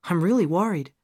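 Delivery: lightly acted anxious caller. A narrator's reading. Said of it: "Gail asked anxiously".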